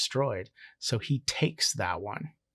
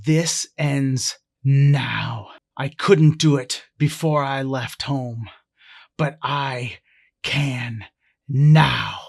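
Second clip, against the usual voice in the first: loud, slow, deliberate fury; angry threat, pausing before a loud final word; 8 dB louder, slower than usual. Acted. Hawking threatens his uncle at gunpoint, so angry. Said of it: "Hawking just becomes so angry".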